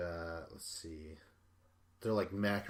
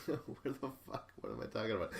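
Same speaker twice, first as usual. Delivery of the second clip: laughing; faster than usual. Real, not acted. Laughs at own tangent.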